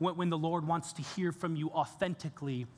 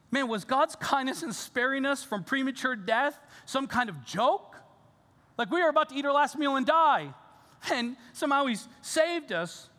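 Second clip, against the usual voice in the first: a higher, louder mother questioning God; lightly voiced grieving mother, pleading; a hint of a distraught mother challenging God; despairing mother; 7 semitones higher, 4 dB louder than usual. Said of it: "hear the despair in the mother's voice".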